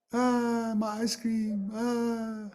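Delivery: put-on high, wailing little kid; wailing child. Child wails over the ice cream on the floor. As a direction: cry out like a kid who dropped his ice cream. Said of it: "the child is screaming".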